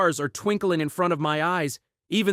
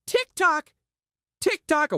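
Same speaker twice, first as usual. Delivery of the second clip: ticking clock sound; 9 semitones higher than usual. A narrator's reading. Clock sound imitated in story.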